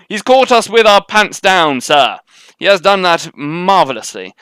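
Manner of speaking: loud, high, drawn-out officer's report; mock officer. Officer reporting to sir on being caught out, hammed-up and loud. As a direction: declaim it slowly, like an officer addressing a superior.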